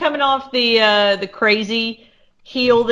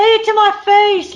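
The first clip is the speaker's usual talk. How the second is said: high, raised-voice heckle; mimicked scream; 12 semitones higher, 7 dB louder than usual. Wrestler screaming over a rival's promo, mock scream.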